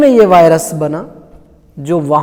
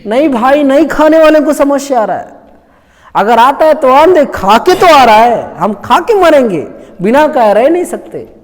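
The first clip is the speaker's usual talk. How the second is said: loud, higher mock protest; defiant retort; 5 semitones higher, 5 dB louder than usual. Preacher mimics tobacco users defending their habit, defiant retort. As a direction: insist stubbornly, imitating the users.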